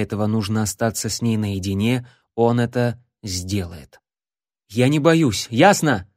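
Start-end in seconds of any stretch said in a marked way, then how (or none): 4.7-6.1 loud defiant blurt. Acted. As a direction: snap it out defiantly. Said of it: "выпалил он".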